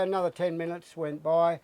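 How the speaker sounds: ordinary talk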